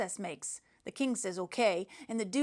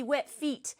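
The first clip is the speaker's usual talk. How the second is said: usual speaking voice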